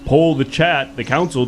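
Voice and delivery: ordinary talk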